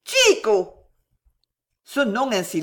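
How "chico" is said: loud, high cry of a name; desperate call. A narrator's reading. Woman calling back the man who leaves, desperate cry. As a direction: call out as if losing him forever. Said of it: "un nom jaillit de ses lèvres frémissantes comme un appel éperdu".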